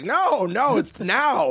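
high patient guard. Retold or imitated. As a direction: gently correct them, up high. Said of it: "And he's like".

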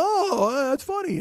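high-pitched imitation of a tickled man; retold amused reaction. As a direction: mimic someone pleasantly amused by a joke. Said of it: "he laughs and he goes".

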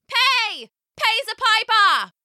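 very high, urgent demand; villagers calling out. A narrator's reading. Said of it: "started to call out".

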